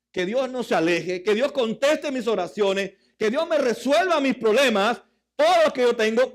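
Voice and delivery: preacher stirring the crowd; preaching. Acted. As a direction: build the list up with fire. Preacher building to fervor.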